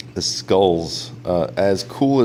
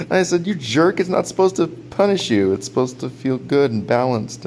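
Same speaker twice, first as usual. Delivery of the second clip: mock protest; 6 semitones higher than usual. Acted comeback to his friend.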